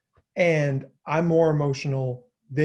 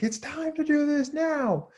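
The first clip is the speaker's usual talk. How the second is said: high, slow, strained mock yell at the kid; hammed-up exasperated parent; mock screaming parent; 12 semitones higher, slower than usual. Shown as a demonstration. Parent losing it over homework, high mock yell. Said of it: "between an instruction and screaming".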